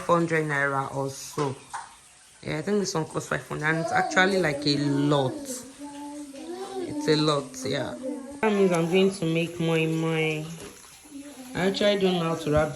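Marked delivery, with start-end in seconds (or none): 5.3-7.5 drawn-out exclaim. Real, not acted. Exaggerated drawn-out complaint about cost.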